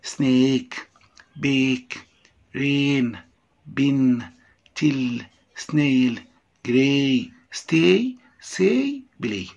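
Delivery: word drill from "سنيك" to "بلاي", each long vowel held; teacher's word list, "جراي" and the rest with drawn-out vowels. Shown as a demonstration. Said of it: "دي حروف طويلة".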